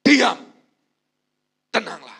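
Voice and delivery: loud firm command; Jesus rebuking the storm, loud and firm; commanding rebuke. Acted. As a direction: order the storm to be still. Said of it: "mulai mengangkat tangan dan berkata".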